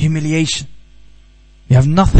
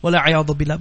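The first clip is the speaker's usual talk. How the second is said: ordinary talk, nothing marked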